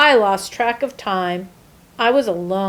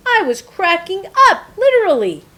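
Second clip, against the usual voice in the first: frantic; 10 semitones higher, 5 dB louder, faster than usual. Acted. Egg cracking up under pressure.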